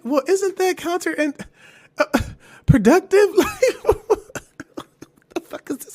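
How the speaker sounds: high, put-on sarcastic voice with a laugh; mock sarcasm. Mocking the depression warning, high sarcastic voice. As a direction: sneer at the label, laughing. Sarcastic reaction to side effect.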